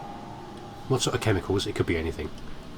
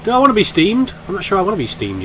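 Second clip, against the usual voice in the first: mock alarm; 10 semitones higher, 5 dB louder than usual. Real, not acted. Nervous about being steamed.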